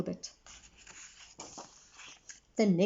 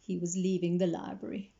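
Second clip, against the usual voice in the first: usual speaking voice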